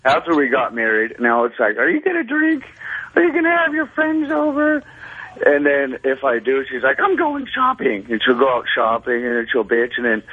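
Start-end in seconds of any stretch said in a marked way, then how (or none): 1.8-4.8 high, whiny wife impression; mocking the wife's complaints; mock nagging wife. Caller mimics nagging wife. 7.0-8.1 high, slow huffy wife voice; wife storming off shopping, mimicked; mock wife. Caller mimics wife's threat.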